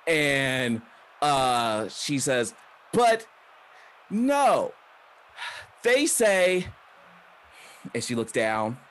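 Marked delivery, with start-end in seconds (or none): 2.9-6.7 higher, halting voice with long pauses; indignant promo. Acted. Wrestler tells crowd what doctors say, indignant. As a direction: break it up for drama, indignant.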